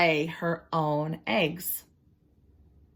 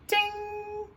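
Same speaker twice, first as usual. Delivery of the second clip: high sung ding like a bell; teacher imitating a class bell; mouth bell ding; 3 dB quieter than usual. Shown as a demonstration. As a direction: ring it like a bell. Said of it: "make a little ring with my mouth".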